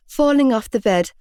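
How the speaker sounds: ordinary delivery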